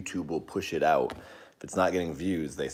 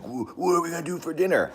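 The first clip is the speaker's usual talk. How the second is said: high-pitched, stammering mock fluster; imitated freak-out; 12 semitones higher than usual. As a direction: fret in a high voice, aping flustered men.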